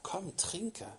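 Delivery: soft whispered invitation. Acted. Preacher voicing God's gentle call to the thirsty, in a whisper. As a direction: whisper it like a tender secret.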